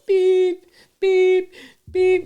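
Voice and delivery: high, loud electronic-style beeps; truck reversing beeps. Retold or imitated. Imitating a fire truck backing down the hill, high beeps. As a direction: mimic a backing-up alarm on one high note. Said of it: "you can hear, like, a fire truck".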